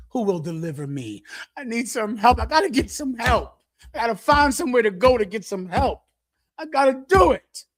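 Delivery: put-on a drunk talking himself into getting help, mounting urgency; imitating alcoholic desperation. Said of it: "That's how they talk".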